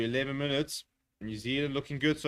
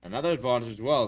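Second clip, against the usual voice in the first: usual speaking voice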